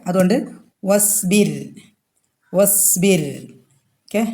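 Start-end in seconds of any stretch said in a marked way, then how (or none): 0.8-3.2 വസ്ബിർ ending in a thin, light r. Shown as a demonstration. Teacher showing ra after kasra, light final r. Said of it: "light ആക്കുക".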